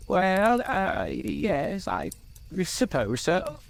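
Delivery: high, halting Bilbo, caught out; stammering. A narrator's reading. Bilbo owns up to Gandalf, stammering. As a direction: stumble over it in a high, reluctant voice. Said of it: "stammered Bilbo".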